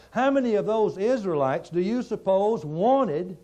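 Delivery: preaching at full force. Acted. Preacher rousing the congregation toward the lesson, full force. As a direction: build it up like a revival pulpit.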